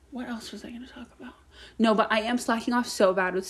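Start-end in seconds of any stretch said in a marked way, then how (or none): 0.1-1.3 breathy whispered aside to herself. Real, not acted. Podcaster whispering an aside to herself. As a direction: murmur it under your breath.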